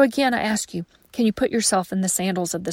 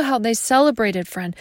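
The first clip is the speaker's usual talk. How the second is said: ordinary talk, nothing marked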